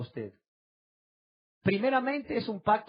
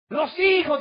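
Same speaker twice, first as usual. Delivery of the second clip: much higher, louder mock reply; mock everyone's answer; 11 semitones higher, 11 dB louder, slower than usual. Pastor mimicking the congregation's usual answer, mock reply. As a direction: toss it out like the crowd's eager answer. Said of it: "dice todo el mundo".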